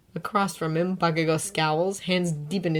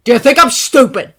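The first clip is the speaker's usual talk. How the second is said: louder, higher gunshot retort; ringing shout; 7 semitones higher, 13 dB louder than usual. A narrator's reading. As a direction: fire it off like a gunshot. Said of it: "rings out like a gunshot".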